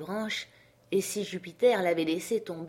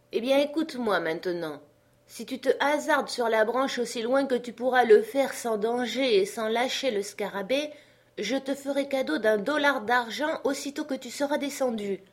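high, insistent bargaining voice; coaxing master; 6 semitones higher than usual. Acted. Master promises Jupiter a dollar, coaxing. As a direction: call up a tempting offer.

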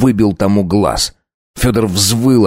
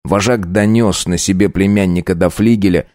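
ordinary delivery

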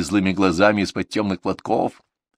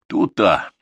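mocking repeat of «тута», flung back as a jibe; «тута» parroted with a sneer; mocking echo; 4 dB louder than usual. A narrator's reading. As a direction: throw back her «тута» with scorn. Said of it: "перебил её Михаил".

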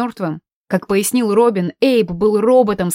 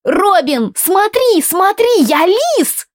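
put-on high, eager child voice; excited little boy voice; 11 semitones higher, 4 dB louder, slower than usual. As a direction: squeal it like a proud kid. Small boy shows off to Robin.

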